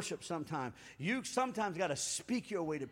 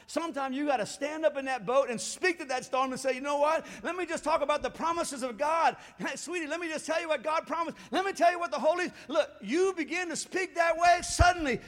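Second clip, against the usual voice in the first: raised, high, driving preacher delivery; fervent preaching; 6 semitones higher, 6 dB louder than usual. Real, not acted. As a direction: build urgently, declaring it to the congregation. Preaching at full force.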